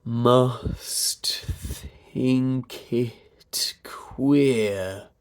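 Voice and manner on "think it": slow, drawn-out "think it"; "think it" stretched slowly through the vowels; pulsed vowels. Shown as a demonstration. Vowel-pulsing exercise demonstrated.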